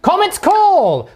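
slow, loud, high proclamation; spell announcement. Acted. Player casting his spell, hammed-up loud announcement. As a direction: belt out the spell name like a hero.

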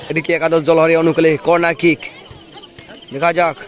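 ordinary talk

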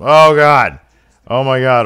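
loud, high outcry at the action; shocked commentator. Acted. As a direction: burst out in ringside alarm. Commentator reacts to flying elbow.

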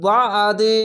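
বা আদি with a thick, throat-squeezed 'a' in the middle; thick squeezed ayn. Shown as a demonstration. Teacher modelling ayn, বা আদি with a squeezed throat sound. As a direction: tighten the throat on the আ of বা আদি, drawn slowly. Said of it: "মোটা করে গলা চিপে পড়বেন".

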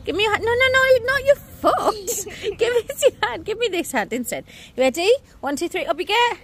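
high, bright, coaxing parent voice. Real, not acted. Mother coaxing her muddy toddler up, high coaxing voice. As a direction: coax a little one gently, up high.

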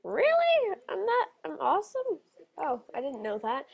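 hammed-up disbelieving squeal; shocked disbelief. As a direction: squeal Really in playful astonishment. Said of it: "And I was thinking".

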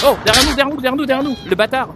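high, loud alarmed warning; urgent callout. Real, not acted. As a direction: shout the warning fast. Enemy behind, urgent callout.

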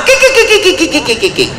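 high, falling mock titter; rapid high-pitched fake giggle that tumbles down; imitated giggling. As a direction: mimic gossiping staff giggling away. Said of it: "ketawa cekikikan di kantin".

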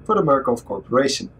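reading the document aloud. Acted. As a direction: read out the obituary text. Reads in-game obituary document.

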